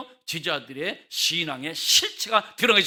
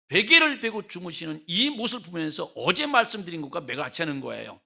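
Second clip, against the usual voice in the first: ordinary talk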